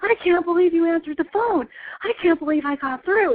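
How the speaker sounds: high, thrilled retelling of the moment she got through; excited disbelief. Retold or imitated. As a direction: squeal it like you just won. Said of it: "And I just started screaming".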